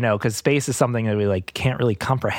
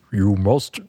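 trying a sun voice; 4 semitones lower than usual. Acted. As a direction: lower trial sun voice. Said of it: "what should my sun voice be?".

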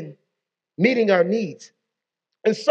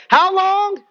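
preacher's call; 8 semitones higher than usual. Real, not acted. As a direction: rouse the room to answer. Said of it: "call and response".

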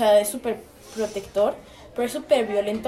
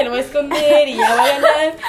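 louder, giggling recall of own scheme; laughing through quote; 2 semitones higher, 7 dB louder than usual. Retold or imitated. Recalling hiding the remote, laughing loudly. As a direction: laugh out the old plan. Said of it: "Entonces yo dije".

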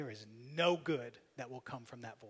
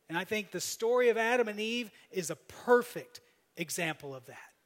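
louder, higher sermon climax; preaching at full force; 6 semitones higher, 8 dB louder than usual. Acted. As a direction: drive it home to the congregation.

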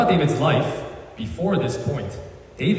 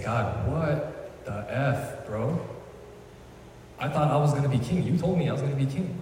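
frustrated; 6 dB quieter than usual. Retold or imitated. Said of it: "How frustrating".

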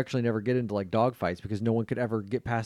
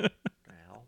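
whisper; 3 semitones lower, 4 dB quieter than usual. Pretend. Koala reaction, unvoiced.

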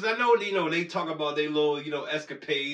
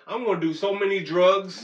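loud, mock-defiant boast; playful threat; 4 semitones higher, 6 dB louder than usual. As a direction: declare it like a rebel. Joking threat to fail test.

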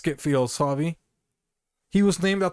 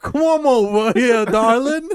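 put-on hammy Italian accent; 5 semitones higher, 7 dB louder than usual. Podcaster imitating a governor luring women, mock Italian accent. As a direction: lay the Italian charm on thick. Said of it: "he has a horrible Italian accent".

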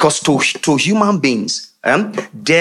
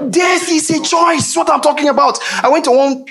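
emphatic preaching; 14 semitones higher than usual. Real, not acted. Sudden high emphatic preaching.

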